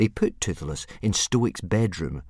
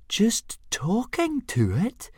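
much higher, slow, incredulous squeak; astonished gasp; much slower than usual. Acted. Fishlegs astonished that Hiccup talks to a dragon, incredulous. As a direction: gasp it out in disbelief. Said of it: "gasped Fishlegs in astonishment".

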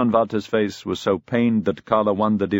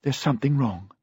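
higher, understated unsteadiness; a touch shaken; shaky voice; 4 semitones higher, 3 dB quieter than usual. A narrator's reading. Daughter telling her father her doubts, shaky. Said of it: "in a shaky voice".

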